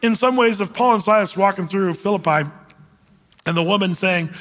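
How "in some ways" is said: ordinary talk, nothing marked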